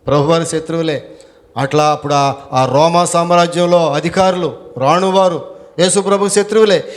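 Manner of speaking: usual speaking voice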